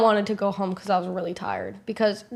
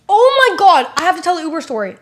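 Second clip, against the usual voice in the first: much higher, louder, fast burst; excited realization; 8 semitones higher, 10 dB louder than usual. Real, not acted. Vlogger suddenly remembering a story for viewers, excited burst. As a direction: blurt it as the memory hits.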